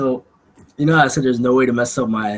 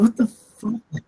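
stunned disbelief; 11 semitones higher, much slower than usual. Retold or imitated. Shocked at botched name.

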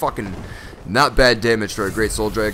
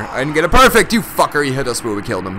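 usual speaking voice

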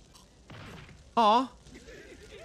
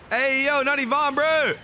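high, loud protest; dismayed yell; 9 semitones higher, 6 dB louder than usual. Real, not acted. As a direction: yell at the screen, pleading. Dismay at character's fate.

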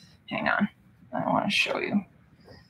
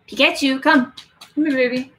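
lightly raised coaxing pet voice; calling the cat; 4 semitones higher than usual. Real, not acted. Streamer calling her cat back to show the chat, light coaxing pet voice. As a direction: coax the cat over, sweetly.

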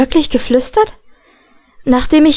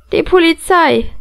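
swaggering; 4 semitones higher than usual. A narrator's reading. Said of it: "begann Matthew großspurig".

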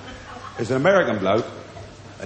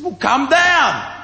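calling up; 10 semitones higher, much slower than usual. Retold or imitated. Skinhead calling up from below.

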